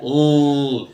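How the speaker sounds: ل held long and low as "ulll"; low, drawn-out ل sound; sustained dark L. Shown as a demonstration. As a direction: stretch ل like the end of uncle. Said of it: "بنطقها كده".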